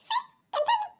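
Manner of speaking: put-on very high childish squeak. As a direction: squeal it like a small kid. Child voice repeating phrase. (ಎತ್ತರದ ಮಗುವಿನ ಧ್ವನಿ)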